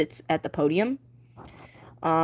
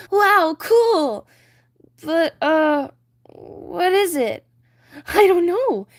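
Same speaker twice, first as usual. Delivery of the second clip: excited; 9 semitones higher, 5 dB louder, slower than usual. Acted. Flounder and Ariel marvel.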